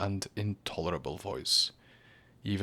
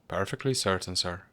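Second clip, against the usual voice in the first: plain reading voice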